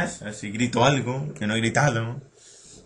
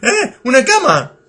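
loud, high excited shout; alegría repentina; 12 semitones higher, 11 dB louder than usual. Real, not acted. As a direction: shout it as a sudden happy find. Spots a bed in game. (grito agudo de alegría repentina)

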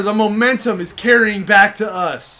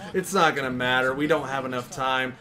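dejected; 7 semitones lower, 9 dB quieter than usual. Real, not acted. Losing the game late.

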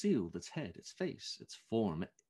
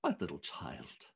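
low, soft grief; hushed, sinking lament; breaking down; 8 semitones lower, 9 dB quieter than usual. A narrator's reading. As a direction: grieve quietly as a bereaved father. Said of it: "He broke down all at once".

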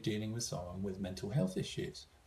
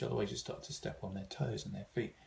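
usual speaking voice

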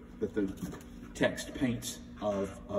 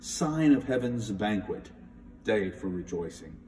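louder, measured reading of the verse; solemn hymn reading; 2 semitones higher, 7 dB louder than usual. Shown as a demonstration. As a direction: proclaim the verse like a celebration. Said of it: "I'd like to read the text".

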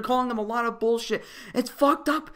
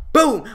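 punched, higher boom; loud mock "boom"; 4 semitones higher, 8 dB louder than usual. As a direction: imitate an impact with a loud boom. Acting out hitting someone in game.

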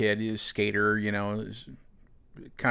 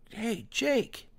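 high-pitched imitation of the girl calling down; mimicking her high call from the bleachers; 13 semitones higher than usual. Narrator imitating the girl calling down to him, high mock voice. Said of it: "she yells down".

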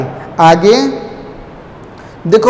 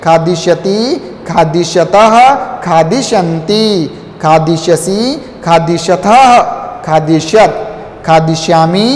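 recitation drill; 3 semitones lower than usual. Shown as a demonstration. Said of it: "एक बार फिर से दोहरान कीजिए".

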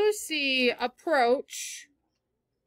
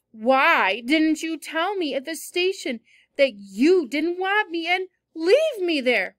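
louder, higher hurt reproach; reproachful Anne; 3 semitones higher, 7 dB louder than usual. Acted. Anne reproaching Matthew for leaving her at the station. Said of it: "she added reproachfully".

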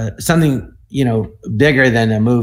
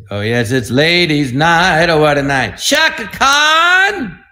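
hammed-up lounge singer crooning slowly; wedding singer act. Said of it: "I could do it for him".

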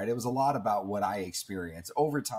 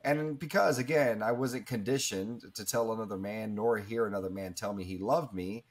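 normal talking voice

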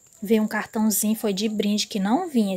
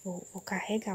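normal talking voice

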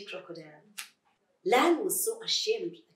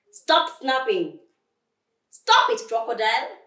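loud, high-pitched scolding; indignant protest; 5 semitones higher, 8 dB louder than usual. Acted. Animals standing up to a bullying crocodile, indignant. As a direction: tell off a bully, loudly.

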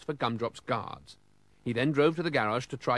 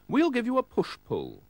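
put-on higher, jolly old gent; Father Christmas voice; 11 semitones higher, 4 dB louder than usual. Father Christmas telling Mister Oldcastle about the sleigh, higher jolly voice. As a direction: play the cheery Santa stand-in.

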